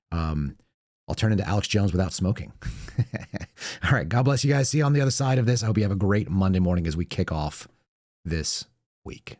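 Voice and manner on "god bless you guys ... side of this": normal talking voice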